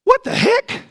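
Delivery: high, loud incredulous outburst; hammed-up perplexed yelp; exasperated bafflement. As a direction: cry out in mock disbelief. Baffled by Jesus waiting four days.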